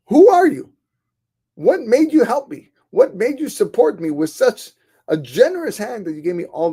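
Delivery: loud, high, insistent questioning; urgent demand. Acted. Scholar asks his mysterious benefactor who he is, urgent.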